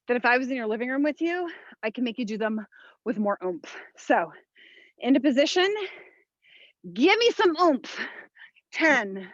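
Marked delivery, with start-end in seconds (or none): 5.0-9.3 barking gym coach. Real, not acted. Coach pushing class through reps.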